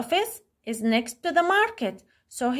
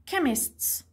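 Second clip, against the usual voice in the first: "Chemists" with the first syllable stressed; 2 semitones higher, 4 dB quieter than usual. Shown as a demonstration. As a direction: hit the opening of "Chemists", let the end fall. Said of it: "اللي المس بتركز عليه".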